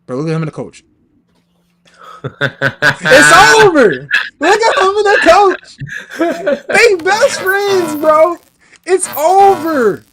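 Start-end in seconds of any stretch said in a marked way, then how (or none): 2.2-10.0 mock wailing cry. Mock grief over Russ photo.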